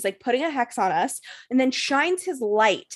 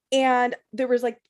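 ordinary talk, nothing marked

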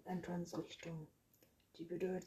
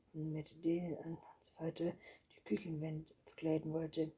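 ordinary delivery

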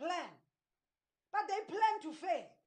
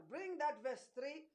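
usual speaking voice

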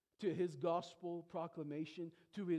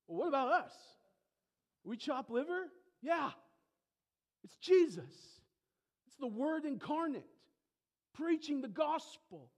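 higher, louder mock indignation; preacher mocking the Pharisees' wounded pride, higher and louder; offended Pharisee; 8 semitones higher, 5 dB louder than usual. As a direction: voice the insulted Pharisees. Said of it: "how deeply offensive".

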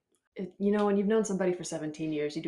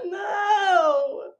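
loud, high cry of no; high shouted mock despair; mock anguished no; 12 semitones higher, 9 dB louder than usual. Real, not acted. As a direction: wail it like it hurts. Horrified at the cake prop.

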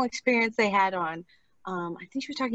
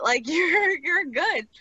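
higher, laughing praise; proud cheer for a client who nailed it; delighted praise; 7 semitones higher, much slower than usual. Retold or imitated. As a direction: beam at her with a laugh. Praising client after success.

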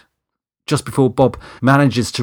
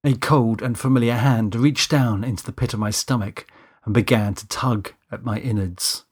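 usual speaking voice

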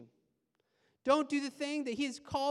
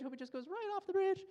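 quick, higher sneering mutter; petty inner voice; 7 semitones higher, faster than usual. Retold or imitated. Pastor voicing his own evil road-rage thought, quick and higher. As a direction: let the spiteful thought slip out fast. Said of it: "small, tiny voice go".

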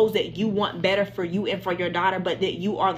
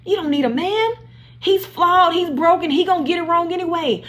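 emphatic outburst; 8 semitones higher, 4 dB louder than usual. Real, not acted. Preacher emphatic to women viewers.

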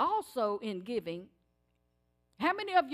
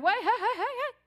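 high, rising calls to stop him; calling out; 10 semitones higher, 4 dB louder than usual. Retold or imitated. Young man calling to the gas attendant, high rising calls.

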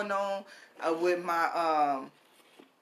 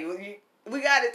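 usual speaking voice

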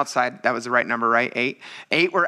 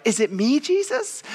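high-pitched mock questioning; worried disciple; 12 semitones higher than usual. Disciple asking Jesus at the Last Supper, high mock-anxious question. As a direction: pipe up with a high, hammed-up question.

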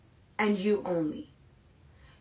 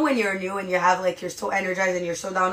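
usual speaking voice

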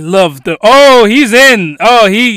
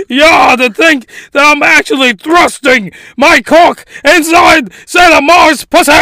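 loud, high, leering triumph; gloating shout; 6 semitones higher, 4 dB louder than usual. Acted. Villain gloating over Sailor Mars, rising to a shout. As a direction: crow it out in triumph.

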